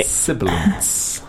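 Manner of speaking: sibilants said slowly and carefully. Shown as a demonstration. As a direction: spell it out slow. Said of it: "Carry on".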